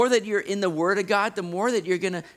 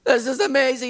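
gushing Peter; 7 semitones higher, 5 dB louder than usual. Retold or imitated. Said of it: "And Peter's just like".

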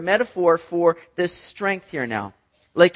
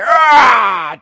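a sudden loud shouted "Ah" from the proud lion; big shouted scoff; lion's shouted growl; 5 semitones higher, 11 dB louder than usual. A narrator's reading. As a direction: bark it out like a king of the jungle. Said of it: "The lion's like".

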